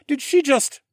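loud, high startled half question; stunned. A narrator's reading. Horus stunned after Zoe's tail flick. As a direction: break off in disbelief.

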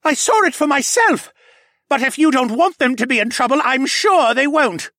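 loud, high bitter protest; furious, high-pitched accusation; spat out. A narrator's reading. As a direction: argue hotly, slowing into the final jab. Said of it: "the ox demi-human spat out".

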